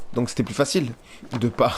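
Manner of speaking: normal talking voice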